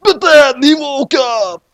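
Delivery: high, loud, slow reveal; dramatic twist reveal. A narrator's reading. Narrator revealing it was a dream. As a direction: land the twist.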